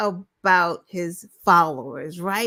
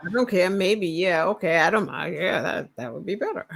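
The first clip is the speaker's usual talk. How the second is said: mock Trump caving; 6 semitones lower, faster than usual. Cohost mocking Trump backing down, lower meek voice. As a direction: play him caving in meekly.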